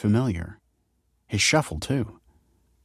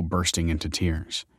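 usual speaking voice

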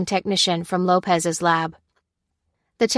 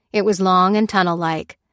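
usual speaking voice

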